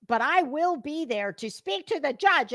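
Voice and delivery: normal talking voice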